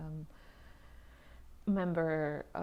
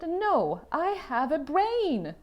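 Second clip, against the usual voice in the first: defiant retort; 7 semitones higher, 10 dB louder, much slower than usual. Retold or imitated. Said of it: "And I said".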